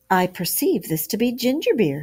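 a touch of pleased approval; approving. A narrator's reading. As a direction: savour the discovery, approving. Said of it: "he remarked approvingly".

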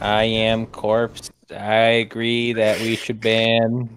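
very low, slow deep voice. Acted. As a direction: drag it out in a deep drone. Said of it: "can you do a good corpse impression?".

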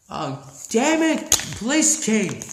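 ordinary talk

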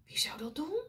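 hushed suspense whisper; breathless storyteller whisper. Shown as a demonstration. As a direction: whisper it to build suspense for the kids. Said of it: "unvoiced suspense question".

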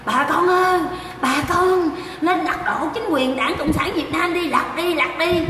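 high, slow mock rallying cry; high mocking crowd-rouser voice; mocking a rabble-rouser. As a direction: ham up her call to overthrow. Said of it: "nó lên kêu gào".

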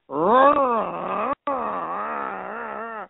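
high blaring honk like a bad trombone; trombone blare imitation. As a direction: mimic a beginner blasting a trombone. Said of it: "I was making this noise like".

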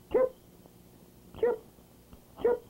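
short high chirps, spaced out; brief birdlike chirp calls; bird chirps. A narrator's reading. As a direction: chirp like a little robin. Robin arrives chirping.

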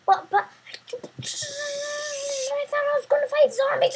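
high, whining complaint; whiny high voice. Acted. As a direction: whine it like a disappointed kid. Whiny kid complaining to NPC.

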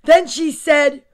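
ordinary delivery, nothing marked

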